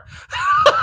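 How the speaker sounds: shrieking burst of laughter; sudden squealing laugh; loud laugh. Real, not acted. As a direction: let out a high, loud cackle. Laughs at own remark.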